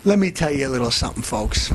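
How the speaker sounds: tough guy opener. Acted. Brash host warning the folks, low and quick. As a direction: lean in and lay it down.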